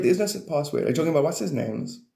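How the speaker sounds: ordinary delivery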